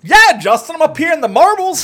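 high, loud cartoon Canadian voice; a hammed-up Canadian from up north; mock Canadian accent. Telling Justin where he lives, in a mock Canadian accent.

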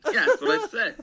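amused and a little defensive; laughing admission. Real, not acted. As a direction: own up through laughter. Both laughing at the fake order.

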